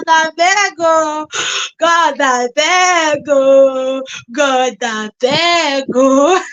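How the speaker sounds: slow, loud, stretched begging through laughter; laughing plea. Real, not acted. Vlogger recalling meeting a subscriber, slow loud laughing plea. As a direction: drag out each beg while laughing.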